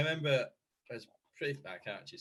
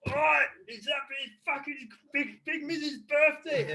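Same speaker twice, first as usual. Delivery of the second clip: loud Cockney mic shout; Cockney accent; 8 dB louder, slower than usual. Retold or imitated. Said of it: "in my steaming Cockney accent".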